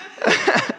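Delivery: short airy giggle; high breathy laugh. Real, not acted. Pastor laughing at own joke, airy laugh. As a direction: burst into a quick laugh.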